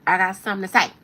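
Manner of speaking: loud, hammed-up anime burst. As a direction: blurt it big like a cartoon rapper. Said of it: "she's real anime like that".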